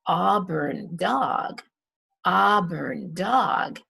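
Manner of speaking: auburn dog said slowly with an open ah; slow teacher modelling of auburn dog; slow phonics demo. Shown as a demonstration. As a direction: draw out the ah in auburn.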